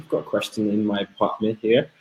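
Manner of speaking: a playful hint of British; mock British accent. Host slips into British accent on podcast. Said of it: "I don't know why I'm speaking like that".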